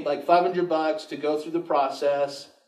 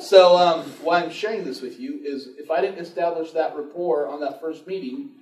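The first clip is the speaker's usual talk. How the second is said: ordinary delivery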